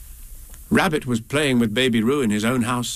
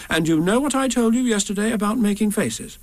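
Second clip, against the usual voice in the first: mild severity; 9 semitones higher than usual. Acted. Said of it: "said Kanga rather severely".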